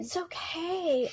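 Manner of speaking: slow, soft reassurance; tender soothing. Retold or imitated. Soothing the inner child, slow and tender. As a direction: ease a crying child with a slow hush. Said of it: "soothing an upset child".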